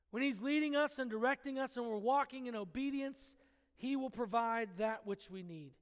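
usual speaking voice

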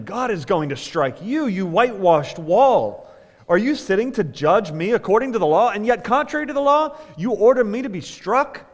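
loud, high-pitched indignant reading; indignant rebuke. A narrator's reading. Paul rebuking the high priest who had him struck, indignant. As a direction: read it as a forceful, rising challenge.

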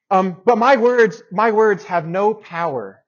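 high, loud and slow; emphatic declaration. Real, not acted. Preacher stressing that his words are powerless, loud and slow. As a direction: land the point hard.

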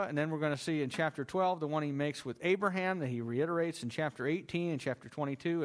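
ordinary talk

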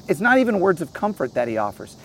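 ordinary talk

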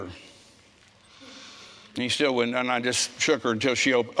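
usual speaking voice

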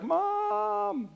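put-on high child's cry of Mom; child calling Mom. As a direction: call out like a little boy far away. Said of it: "the little son's like".

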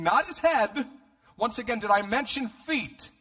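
high, loud incredulous emphasis; comic outrage. Real, not acted. Preacher grossed out by anointed feet, comically aghast.

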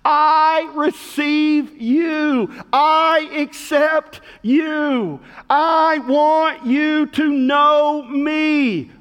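slow, tender and gentle; a slow, gentle voicing of Jesus' welcome; tender voice of God. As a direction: speak as God welcoming them, slowly. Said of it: "here's what he says".

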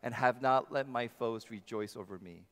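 loud, rising scripture reading with the congregation; reading scripture aloud together. Real, not acted. Pastor leading his church in reading Psalm thirty, loud and lifted. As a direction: lead the psalm out loud for all to join. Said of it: "Could you join me?".

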